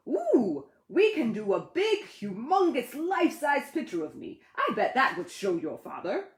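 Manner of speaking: bursting with a big idea; excited Ted. Acted.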